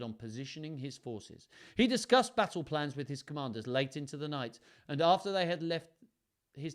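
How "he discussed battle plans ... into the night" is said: plain reading voice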